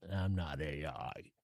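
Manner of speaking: low, quiet deadpan robot voice; flat fake AI voice, half-hearted; mock robot voice. Podcaster joking to listeners, mock robot voice. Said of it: "I can't even do it in a good voice".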